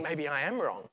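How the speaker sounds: soft, tentative inner voice; self-doubting thought. Acted. Voicing someone starting to doubt, softly unsure. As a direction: drop the volume as doubt creeps in. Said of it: "you started to think".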